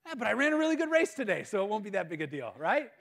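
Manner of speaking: high, loud burst that settles down; hopeful protest. Retold or imitated. Runner reassuring himself he won't be dumped, protesting. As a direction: protest high, then talk yourself down.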